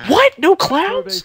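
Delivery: high, loud disbelief; a shocked yelp of disbelief. Real, not acted. Surprise at game answer.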